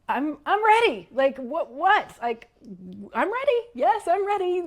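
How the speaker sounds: high, loud, flustered retort; startled insistence. Retold or imitated. Startled man answers when asked if he's ready, high flustered insistence.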